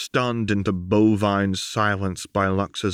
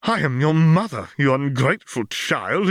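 higher, louder scolding mother; angry mother; 6 semitones higher, 5 dB louder than usual. Acted. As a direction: put the daughter in her place.

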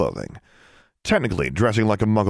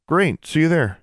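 nonplussed; 9 semitones higher than usual. A narrator's reading. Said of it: "a bit nonplussed".

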